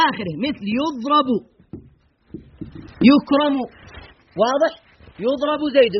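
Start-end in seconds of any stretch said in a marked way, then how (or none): 0.8-1.3 يضرب said as "yudrabu"; u on the first letter of يضرب, a before the last; passive present vowelling. Shown as a demonstration. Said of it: "يضم أوله ويفتح ما قبل". 3.1-3.6 يكرم said as "yukramu"; u on the first letter of يكرم, a before the last; passive present vowelling. Shown as a demonstration. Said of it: "يضم أوله ويفتح ما قبل".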